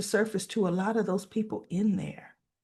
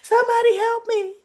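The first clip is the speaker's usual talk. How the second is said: much higher, louder frantic calling; panicked cry for help; 11 semitones higher, 6 dB louder than usual. Retold or imitated. Leslie, scared, calling for help as Peaches chokes, panicked. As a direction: frantically call for a doctor. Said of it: "coughing and coughing".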